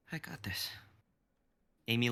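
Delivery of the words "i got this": whispered resolve; whispered to himself. Acted. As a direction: steel yourself under your breath. Kai steeling himself under breath.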